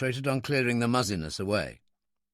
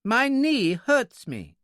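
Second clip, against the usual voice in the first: high, slow, aggrieved little man; accusing; 10 semitones higher, 4 dB louder, much slower than usual. A narrator's reading. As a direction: lay the blame in a high, drawn-out complaint. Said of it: "he said accusingly".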